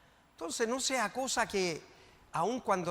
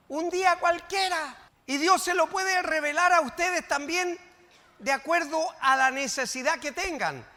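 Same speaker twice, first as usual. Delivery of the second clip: higher, louder preaching push; fervent preaching; 7 semitones higher, 6 dB louder than usual. Acted. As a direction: lift the church with fervour. Preacher stirs congregation.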